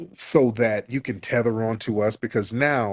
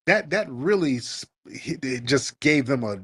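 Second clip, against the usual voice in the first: normal talking voice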